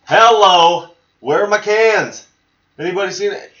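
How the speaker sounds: high, loud, calling out for his cans; retold frantic search. Retelling a skit, calling out for missing cans, high and loud. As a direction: call out like a panicked stoner hunting his stash.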